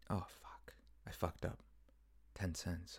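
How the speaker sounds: hushed, whispered dread to oneself; panicked whisper. Retold or imitated. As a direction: whisper it, imitating a sinking panic. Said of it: "I'm, like, panicking. I'm in a panic".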